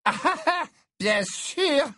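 put-on high, drunken, bitter little laugh; furious tipsy laugh. A narrator's reading. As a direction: laugh sourly through the drink, very high. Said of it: "avec un petit rire aviné et furieux".

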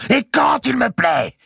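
very high, slow, emphatic shout; enraged howl. A narrator's reading. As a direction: scream each word in defiance. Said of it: "hurla Mao".

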